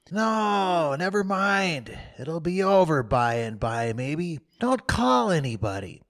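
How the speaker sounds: faked suffering. Tom stopping Sid calling Auntie, feebly brave. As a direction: play brave but in agony.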